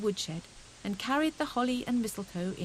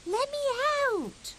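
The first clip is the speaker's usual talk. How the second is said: much higher, muffled squeak; tiny faraway shriek. A narrator's reading. Fuzzy Peg stuck in the snowball, small shriek. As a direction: squeak from far inside. Trapped hedgehog calls.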